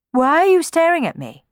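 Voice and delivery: high, loud demand. A narrator's reading. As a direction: demand an answer, pitch jumping up. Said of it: "Cenerentola demanded".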